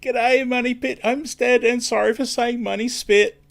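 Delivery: rushing to fix a flubbed name; a flustered high correction; flustered apology. Real, not acted. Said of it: "this is not going well".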